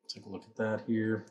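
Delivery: ordinary talk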